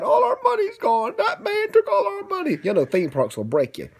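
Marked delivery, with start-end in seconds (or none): 0.0-2.6 high, whiny little lament; mock sorrow for the child; sad. Broke sneetches mourning their money, high and whiny. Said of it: "Then they got sad".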